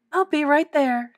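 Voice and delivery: high-voiced ready helper; eager helper. A narrator's reading. Librarian answers call for help.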